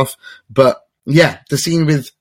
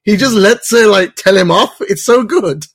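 excited gushing; 10 semitones higher, 4 dB louder than usual. Real, not acted. Gushing over Jorah and Lady Mormont.

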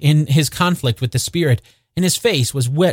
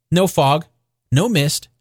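ordinary delivery

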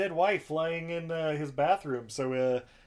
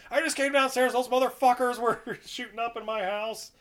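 indignant homeowner; 8 semitones higher, 5 dB louder than usual. Retold or imitated. Homeowner arrested, protesting.

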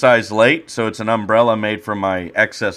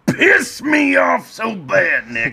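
mock angry shout; 12 semitones higher, 4 dB louder, slower than usual. Mock outburst at friend.

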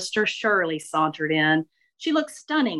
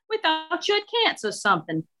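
gossipy Southern lady; 10 semitones higher, faster than usual. Acted. Shirley recounting worry.

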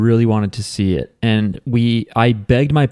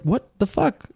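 high, soft incredulous what the fuck; stunned at the betrayal; disbelief; 11 semitones higher, 5 dB quieter than usual. Retold or imitated. Woman denies letting them in, high stunned disbelief.

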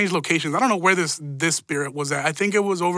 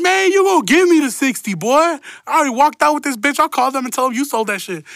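much higher, louder angry retort; heated comeback; 8 semitones higher, 4 dB louder than usual. Retold or imitated. Telling the homie off for skipping the sixty dollars, loud and heated. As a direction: snap back at him, demanding the money.

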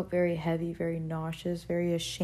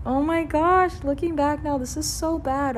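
much higher and louder, bursting out in embarrassed disbelief; flustered disbelief; 10 semitones higher, 6 dB louder than usual. Real, not acted. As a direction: squeal it out, half laughing at yourself. Laughing disbelief at her past.

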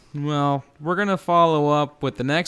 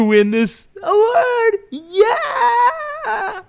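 loud, high shouting with crazed laughter; over-the-top hyped-up celebration at the top of the voice; manic elated winner; 10 semitones higher, 9 dB louder than usual. Acted. Winner raving over the award.

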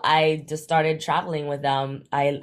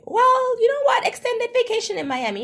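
mock cheerful; 4 dB louder than usual. Forced cheer about being stuck.

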